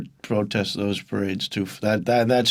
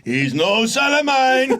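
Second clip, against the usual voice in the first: hammy disowning father; 13 semitones higher than usual. Pretend. Father disowning son, acted.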